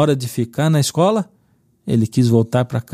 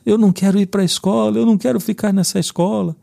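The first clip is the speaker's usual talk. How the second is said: choro de criança imitado; 9 semitones higher than usual. Retold or imitated. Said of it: "E ele começou a chorar".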